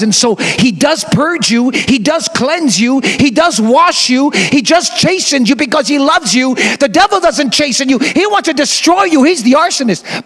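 fervent preaching. Acted. Preacher driving the point home to the congregation, fervent. Preacher builds to full force.

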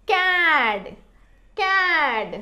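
"క్యాడ్" with long vowel, falling pitch; pronunciation demo. Shown as a demonstration. Teacher modelling how it is read, "క్యాడ్" drawn out. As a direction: stretch "క్యాడ్" as one blended word. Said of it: "అని పలుకుతుంది".

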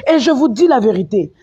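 ordinary talk

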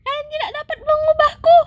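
high put-on cartoon voice, smug; taunting cat voice. Fluffy taunts the kids that they can't change it, high and smug. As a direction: gloat like a cheeky pet.

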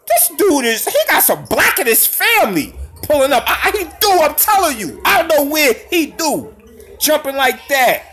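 high, keyed-up amazement; amazed excitement. Real, not acted. Raving about how high the player jumps, excited. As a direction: still buzzing from the dunk. Still amazed after the dunk.

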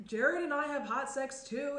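insist, a bit stung; defensive. Acted. Defensive retort.

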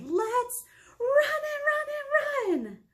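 high, loud and drawn out; sing-song squeal of a running pumpkin; excited squeal. Acted. Pumpkin voiced in rhyme.

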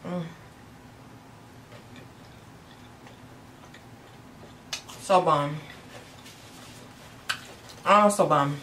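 talking with food in mouth; muffled through chewing. Real, not acted. Seen 1: closed puffed mouth, lips working after taco bites, between words.

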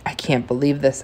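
usual speaking voice

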